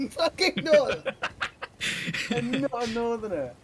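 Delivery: loud, high-pitched mock outrage; indignant shout. Teased friend denies being a northerner, indignant shout. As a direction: protest loudly, pitch jumping up.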